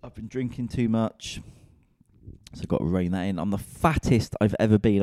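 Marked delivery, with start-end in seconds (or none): 3.4-5.0 blurted out louder, like a confession. Real, not acted. Comedian confessing his weight to listeners, loud blurt. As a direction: announce it with a punch.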